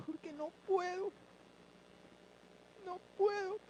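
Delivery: choked-up prayer, rising in pitch; tearful prayer. Retold or imitated. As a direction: plead to God through tears. Tearful prayer retold in testimony.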